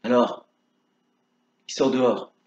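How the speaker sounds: ordinary delivery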